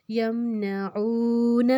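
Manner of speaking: slow یمنعون with "oo" after ain held two beats; long vowel. Shown as a demonstration. Teacher builds the word in parts, lengthened "oo" in یمنعون. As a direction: stretch the ain's "oo" in یمنعون. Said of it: "ہم نے دو حرکت لمبا کیا".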